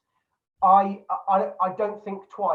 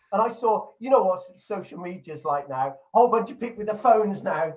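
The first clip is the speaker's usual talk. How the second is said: ordinary delivery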